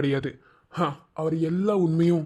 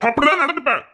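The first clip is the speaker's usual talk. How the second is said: defiant snap; 9 semitones higher, 3 dB louder than usual. Acted. Husband defiant in quarrel.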